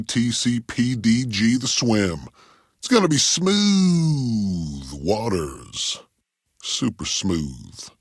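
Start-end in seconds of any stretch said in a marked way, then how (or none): 2.8-7.9 drawn-out, slow smooth-DJ delivery; smooth radio patter. Pretend. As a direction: stretch the words out long and ease downward. DJ hypes his smooth station.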